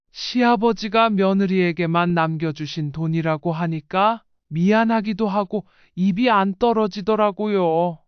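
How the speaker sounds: usual speaking voice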